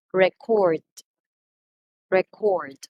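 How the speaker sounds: "Record" as re-CORD, falling at the end; weight the second syllable of each "Record"; verb stress. Shown as a demonstration. Teacher repeats the verb, "Record" stressed on second syllable. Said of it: "when we talk about the verb".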